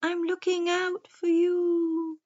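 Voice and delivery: slow, high hooting owl. A narrator's reading. Wise owl warns the forest creatures, slow high hooting. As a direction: draw it out in a high owl hoot. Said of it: "He blinked his big round eyes and said".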